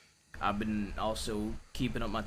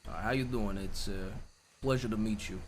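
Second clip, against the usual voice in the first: Italian accent; 2 semitones lower than usual. Shown as a demonstration. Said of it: "That was good".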